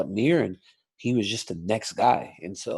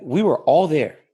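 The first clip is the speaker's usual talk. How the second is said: emphatic louder; 5 semitones higher, 7 dB louder than usual. Real, not acted. Repeats point, more forcefully.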